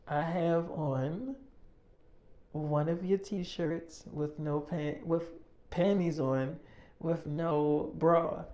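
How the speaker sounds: slow, drawn-out, spelled-out repeat. Retold or imitated. Girlfriend repeating her outfit when asked again, slow and deliberate. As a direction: lay each piece out slowly, as if to make sure he hears it. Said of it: "And you saying you have what?".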